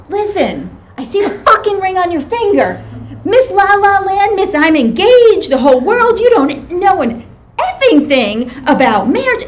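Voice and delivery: loud, high, scornful tirade; furious client. Retold or imitated. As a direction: imitate an angry client tearing into the therapist. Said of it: "the couple starts arguing".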